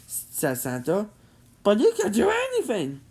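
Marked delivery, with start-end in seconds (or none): 1.6-3.0 pleading. Acted. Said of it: "Sheen pleaded".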